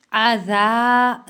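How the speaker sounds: आज़ा with a soft light ज़; soft zal. Shown as a demonstration. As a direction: say the ज़ of आज़ा soft, not heavy. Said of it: "जाल नरम soft". (आज़ा में नरम ज़ाल)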